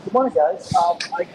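off mic, far from phone; turned away from mic. Real, not acted. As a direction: sounds ten feet from the phone. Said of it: "It sounds like you're off mic there".

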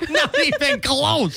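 high burst of laughter then slow. Real, not acted. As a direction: cackle then drawl it. Amused at being asked.

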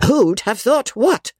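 slow, high-pitched demand; exasperated old lady's high cry; indignant cry. A narrator's reading. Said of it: "cried Miss Crawley, stamping her foot".

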